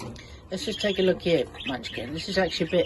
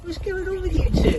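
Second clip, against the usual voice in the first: squeaky pet-talk question; 14 semitones higher, 6 dB louder than usual. Real, not acted. Owner asks two squabbling birds in a squeaky, high pet voice.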